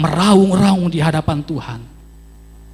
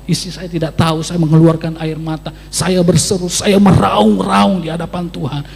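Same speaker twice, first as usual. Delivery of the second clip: ordinary talk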